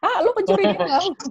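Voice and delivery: high laughing mock accusation. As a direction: tease it through laughter. Retold joke, laughing.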